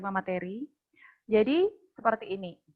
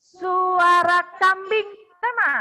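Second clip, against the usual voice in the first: loud, high, slow announcement; calling out; 8 semitones higher, 7 dB louder, much slower than usual. Real, not acted. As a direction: call it out like a game host. Calling out animal sound turns.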